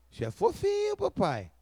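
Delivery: high, sweet toddler voice imitating his daughter; little girl voice. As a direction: coo it like a small child. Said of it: "igual a Ruth, ela olha e fala".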